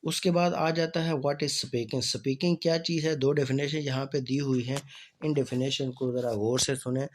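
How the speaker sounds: ordinary talk, nothing marked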